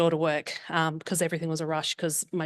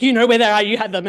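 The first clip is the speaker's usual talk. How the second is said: loud, higher accusing snap; insistent yelling; 4 semitones higher, 9 dB louder than usual. Retold or imitated. Mother losing her temper with her daughter, loud and accusing. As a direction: push hard, accusing her. Retold quarrel with her child.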